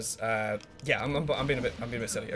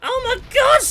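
loud, high-pitched yelp of shock; startled outburst; 12 dB louder, slower than usual. Real, not acted. Gamer reacting to his game, startled shout. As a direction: shout it in shock, then ease off.

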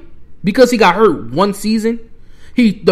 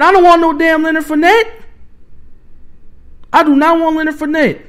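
much higher, heated and indignant; angry outburst; 9 semitones higher than usual. Real, not acted. Fan furious at the idea of trading for Fournette. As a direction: spit the name out like you're fed up. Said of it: "but I'm so mad right now".

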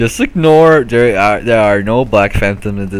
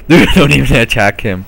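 laughed out through a grin, louder; laughing; 3 semitones higher, 6 dB louder than usual. Real, not acted. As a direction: laugh while pleading. Laughing at another player.

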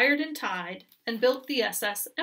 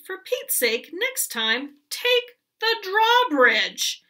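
exasperated queen; 9 semitones higher, 8 dB louder, slower than usual. Acted.

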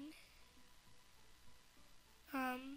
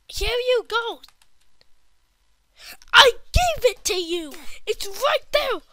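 loud, higher angry shouting; mad yelling; 7 semitones higher, 13 dB louder than usual. Real, not acted. Kid fed up with the game, yelling. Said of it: "very mad right now".

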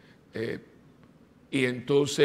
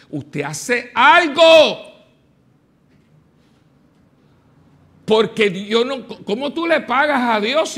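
much louder and higher, slow and pounding; fiery preaching; 8 semitones higher, 11 dB louder, slower than usual. Acted. Preacher urging listeners to give to God, fiery. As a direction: preach it to the back row.

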